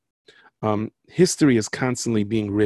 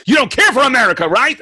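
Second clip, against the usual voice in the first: high, loud, taunting accusation; angry stranger confronting the speaker; accusing shout; 13 semitones higher, 11 dB louder than usual. Retold or imitated. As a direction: jab the question at him. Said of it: "and goes".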